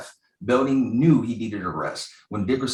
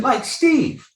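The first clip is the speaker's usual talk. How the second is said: calling out Steve, much higher and louder; shout; 13 semitones higher, 4 dB louder than usual. Real, not acted. Calling out to co-host Steve, loud shout. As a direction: yell the name across the room.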